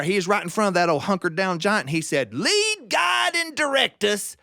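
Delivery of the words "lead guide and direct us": high, drawn-out folksy prayer chant; stretched, high-pitched praying voice; mock prayer. A narrator's reading. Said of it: "when he got into his prayer".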